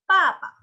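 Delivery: "papa" high and loud, each syllable a crisp P. Shown as a demonstration. Teacher telling students how to read B A B A, emphatic "papa". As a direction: punch out "papa" with P sounds. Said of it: "kalian bacanya".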